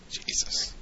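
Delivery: breathy, disbelieving whisper; hushed exasperated aside; whispered jesus. Real, not acted. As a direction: mutter it under your breath in disbelief. Disbelief at Tim firing fans.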